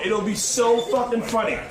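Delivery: ordinary delivery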